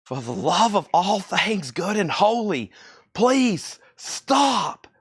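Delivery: slow, high-pitched comic begging; Chris Farley. Acted. Seller begging for all things good and holy, hammed-up impression. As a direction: drag it out, then wail high on the plea. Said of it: "do that in the Chris F-, Farley voice".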